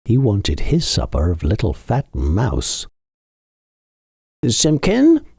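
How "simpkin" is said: higher, rising call to the cat; old tailor calling. A narrator's reading. Tailor calls cat again.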